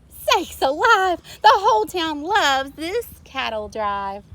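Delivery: a mayor won over by the parade; mayor exclaiming. A narrator's reading. Mayor marvelling that the town loves the cows. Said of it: "The mayor muttered".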